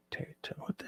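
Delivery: hushed whisper trying to name the song. Real, not acted. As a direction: whisper the question to yourself. Aside while music plays.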